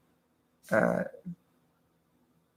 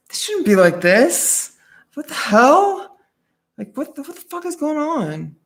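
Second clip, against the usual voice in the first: rising alarm; 9 semitones higher, 3 dB louder than usual. Retold or imitated. Catching on to a manipulator, she grows louder and higher in alarm. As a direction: let the suspicion burst out. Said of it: "I'm like".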